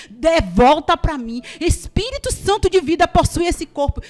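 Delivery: loud, high, desperate invocation; reenacted full-force pleading prayer; shouted prayer. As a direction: cry out to bring the child back. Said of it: "e a gritar dessa forma".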